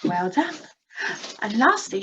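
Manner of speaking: usual speaking voice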